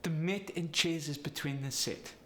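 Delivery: Polish accent, long E becoming short I. Shown as a demonstration. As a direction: Polish accent with clipped E vowels. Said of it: "The E sound goes to the I sound".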